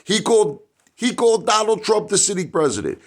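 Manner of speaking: nearly shouting the outrage; outraged exclaiming. Real, not acted. Venting to viewers about Biden's gaffe, loud outraged disbelief.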